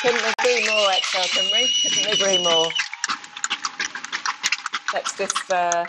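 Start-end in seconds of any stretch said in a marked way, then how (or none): 0.0-2.7 loud, fervent agreement; a rally supporter calling out loud agreement; emphatic agreement. Real, not acted. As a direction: cheer him on, all in. Cheering the protest speech loudly.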